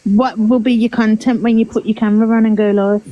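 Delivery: ordinary talk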